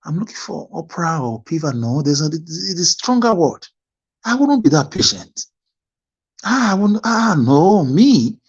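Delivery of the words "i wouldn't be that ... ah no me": higher-pitched, exclaimed refusal; mock protest. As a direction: protest that it could never be you. Imagines himself needing Obi's patience.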